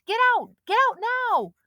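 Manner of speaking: high, loud desperate yell; boy yelling at friends in the creek; frantic shouting. A narrator's reading. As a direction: shout them out of the water. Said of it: "he screamed".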